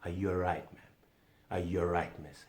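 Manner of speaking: softly asking if she's all right; gentle concern. Retold or imitated. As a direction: check in tenderly. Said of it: "look at her and ask her".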